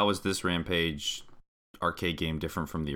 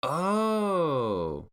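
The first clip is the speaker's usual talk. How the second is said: surprised; 10 semitones higher, 3 dB louder than usual. Real, not acted. Surprised at the callback.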